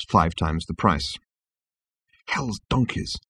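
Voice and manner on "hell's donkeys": muttered under the breath; muttering. A narrator's reading. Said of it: "muttered Zaphod".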